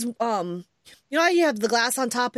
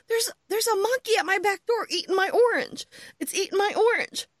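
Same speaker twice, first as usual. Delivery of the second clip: high, excited mock retelling of the neighbor; imitating the guy; 9 semitones higher than usual. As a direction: play the panicked neighbor, pitched up. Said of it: "and he's like".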